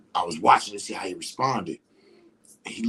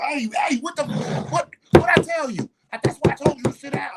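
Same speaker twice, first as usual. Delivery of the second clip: much higher, louder scolding shout; stern parent yelling; 4 dB louder than usual. Retold or imitated. Parent yelling at a toddler over spilled juice, angry shout. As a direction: bark at the kid like a drill sergeant. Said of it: "talking to me like I'm a soldier".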